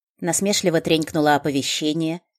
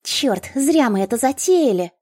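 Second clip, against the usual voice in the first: worried gnome; 9 semitones higher, 5 dB louder than usual. A narrator's reading. Gnome regrets.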